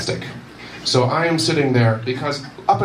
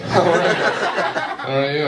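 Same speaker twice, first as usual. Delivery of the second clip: slow, high greeting; 8 semitones higher, much slower than usual. Retold or imitated. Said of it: "I'm like".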